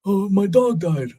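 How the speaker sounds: high, hammed-up sheepish excuse; mock excuse. Host mocking the Twitter guy's excuse, high hammed-up sheepish voice. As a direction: fake a lame excuse in a silly high voice.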